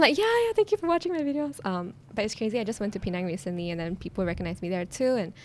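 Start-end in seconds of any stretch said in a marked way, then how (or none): 0.2-1.6 high-pitched, put-on polite thanks; polite fan greeting. Guest re-enacting her reply to strangers who recognise her, polite greeting. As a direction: thank them in a raised little voice.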